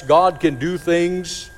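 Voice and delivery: ordinary talk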